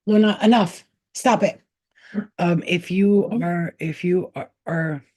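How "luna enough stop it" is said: loud, higher firm command; scolding the cat. Real, not acted. Owner orders her cat to stop, loud and firm. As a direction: cut the cat off hard.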